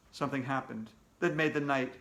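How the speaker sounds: ordinary delivery, nothing marked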